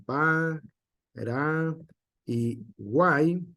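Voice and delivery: «pa», «ra» y «guay» dichas por separado y despacio; separando sílabas. Shown as a demonstration. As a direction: silabear «pa, ra y guay» con pausas entre cada sílaba. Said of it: "no se divide en". (sílabas marcadas una por una)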